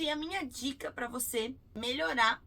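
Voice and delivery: normal talking voice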